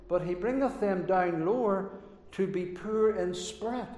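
ordinary talk, nothing marked

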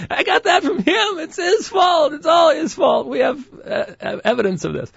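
high squeaky mock shout; silly high voice. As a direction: whine the blame in falsetto. Jokingly blames co-host for meanness.

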